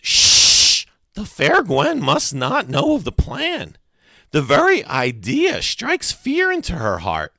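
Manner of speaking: put-on urgent shush, then high theatrical boy's voice; high, loud, melodramatic warning; dramatic secrecy. A narrator's reading. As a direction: hush them with grand, drawn-out drama. Freak hushes friend about secret plan.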